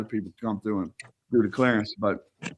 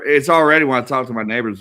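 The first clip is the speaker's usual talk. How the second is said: normal talking voice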